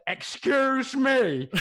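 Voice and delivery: high, slow, prim "excuse me"; stern stout lady. Retold or imitated. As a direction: play a stern stranger scolding. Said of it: "like that".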